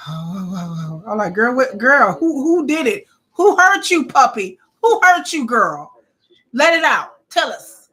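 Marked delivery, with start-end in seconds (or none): 0.1-1.1 lightly mocked timid hesitation; scared Puppy. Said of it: "Puppy always scared". 1.3-7.9 high, loud pleading interrogation; mock concerned demand. Acted pleading interrogation of scared Puppy.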